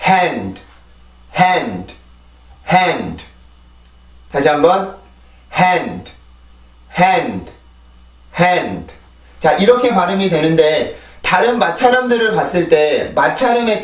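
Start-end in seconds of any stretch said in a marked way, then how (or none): 0.1-8.9 'Hand' as one falling stressed syllable, breath of H first; English pronunciation drill. Shown as a demonstration. Teacher modelling for learners, one-syllable English 'hand'. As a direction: stress the vowel in 'hand', no vowel after d. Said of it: "일단 발음하고 설명을 드릴게요".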